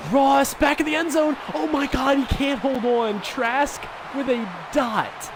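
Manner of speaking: high, eager play-by-play call; excited call. Real, not acted. Near-catch in the end zone.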